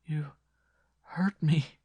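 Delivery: high, pained moan broken by a pause. A narrator's reading. Woman held too tightly by the man, moaning. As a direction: moan it in pain, haltingly. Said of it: "she moaned".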